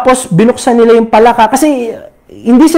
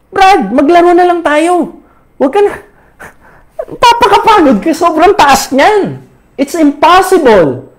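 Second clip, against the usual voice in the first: friends discouraging; 6 semitones higher than usual. Acted. Friends talk frog out of trying.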